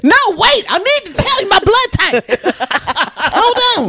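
high, excited joking oversharing; mock eager. She jokes about oversharing with the chat, in a high eager mock voice. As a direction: squeal it out like an eager blabbermouth.

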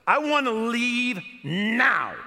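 slow, loud, high, every word pressed; insistent demand. Retold or imitated. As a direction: demand it, spelling it out. Demanding to leave.

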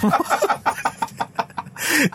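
breathless squeaky giggle; high laugh. Real, not acted. Cracking up after translating the comment, breathless squeak.

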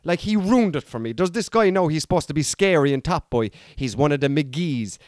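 ordinary talk, nothing marked

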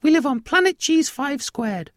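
very high, loud squeaky mouse; high-pitched cartoon mouse voice; tiny alien mouse. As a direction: squeak it out like a proud little mouse. Tiny alien mouse speaking in story.

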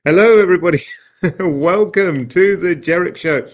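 upbeat, loud TV host greeting; show host intro. Acted.

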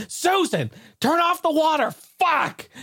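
high mock yell at his wife; neighbor cursing. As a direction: pitch it up high and frantic, cursing. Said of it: "And then I just hear him".

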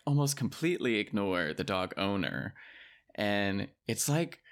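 normal talking voice

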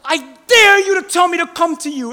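loud, high playful dare; daring challenge. Retold or imitated. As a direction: dare him like a cocky kid. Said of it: "Peter's like".

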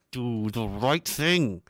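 slow, mocking authority voice; drawn-out mock command; mock stern command. Mocks authority slogan.

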